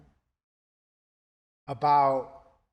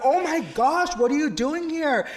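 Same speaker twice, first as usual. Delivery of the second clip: high, louder, delighted astonishment; light up at the surprise; excited surprise; 11 semitones higher, 4 dB louder than usual. Retold or imitated. Teacher asks his old student why he is here, high and delighted. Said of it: "and I'm like".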